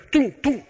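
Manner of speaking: mock gunfire sounds. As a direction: fire off tú tú like a comic-book blaster. Disparos de la mujer maravilla. (imitando disparos en broma)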